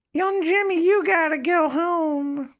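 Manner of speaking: put-on very high, slow old lady falsetto; grandma voice. Friend's grandma sending Jimmy home, high slow old-lady voice. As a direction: squeak it like a frail grandma. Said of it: "she was like".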